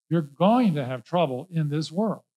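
ordinary delivery

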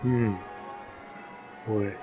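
ordinary talk, nothing marked

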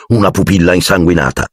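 ordinary delivery, nothing marked

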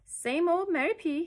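high, loud, cheeky delivery; perky, high-pitched teasing; amused starling. A narrator's reading. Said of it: "The starling laughed".

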